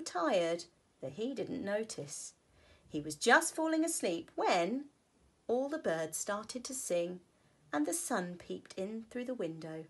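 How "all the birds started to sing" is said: ordinary delivery, nothing marked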